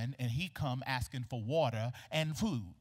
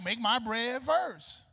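slow, louder mock-quote of a demand; exasperated mimicry; 8 semitones higher, 4 dB louder, much slower than usual. Indignant widow repeating the prophet's demand, exasperated. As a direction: echo the demand slowly, in disbelief.

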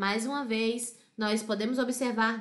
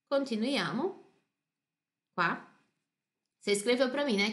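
ordinary talk, nothing marked

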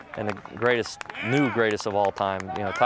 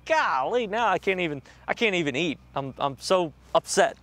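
mounting anxiety; 8 semitones higher than usual. Retold or imitated. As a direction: act out fretting, high and agitated. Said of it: "anxiety level was so high".

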